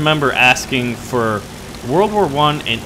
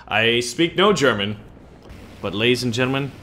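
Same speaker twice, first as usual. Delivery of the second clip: normal talking voice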